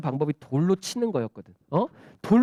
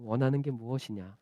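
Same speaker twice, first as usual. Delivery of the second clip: soft, low, tender question; gentle voice; 7 semitones lower, 8 dB quieter than usual. Acted. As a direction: ask softly as a kind master. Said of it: "그 부드러운 음성으로".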